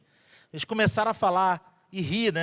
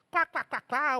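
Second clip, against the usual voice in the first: higher staged mocking cackle; mocking crowd laugh; 8 semitones higher than usual. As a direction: cackle at the idea. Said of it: "O pessoal começou a rir".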